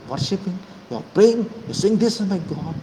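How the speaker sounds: high, loud accusing shout; rising fury. Real, not acted. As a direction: build to a furious peak. Accusing opponents of revering Godse.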